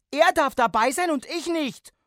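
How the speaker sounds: put-on high, loud outburst of a child's rage; shrieked jealous fury; furious child. A narrator's reading. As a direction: yell it as an enraged kid. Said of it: "sagte ich keuchend vor Wut".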